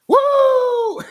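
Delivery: high whooping cheer; crowd cheer. Retold or imitated. Crowd erupting after the shock, high whoop.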